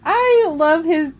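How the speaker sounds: high, slow gushing. Real, not acted. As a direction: swoon over him. Gushing over Bruce Campbell.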